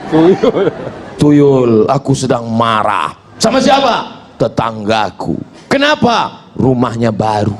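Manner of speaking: comic dialogue with a spirit. Pretend.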